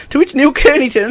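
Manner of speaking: ordinary talk, nothing marked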